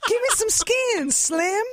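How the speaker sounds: high-pitched mock jive talker; time-traveled slang. Comic shows how outdated her Russian sounded, high jive voice. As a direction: play an outdated hipster trying street talk. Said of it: "I sound like the equivalent of someone who, like, time traveled".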